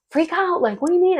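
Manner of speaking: high, disbelieving retort; can't believe he said freak out; incredulous. Retold or imitated. To her calm boyfriend, a high incredulous retort.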